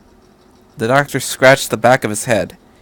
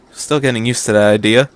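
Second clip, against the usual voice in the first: plain reading voice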